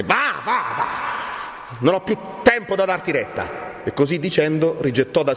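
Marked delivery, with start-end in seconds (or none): 0.0-3.8 high, impatient cry; indignant dismissal. Acted. Friar has no more time for Renzo, indignant. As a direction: send him off with rising indignation.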